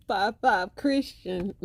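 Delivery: lightly voiced California homie; California accent. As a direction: imitate the clip's homie, drawing it out. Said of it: "He said".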